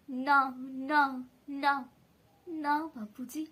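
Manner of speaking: slow, heavy protest, each no stretched out; anguished denial. A narrator's reading. Subhas answers Bapuji's question, anguished denial.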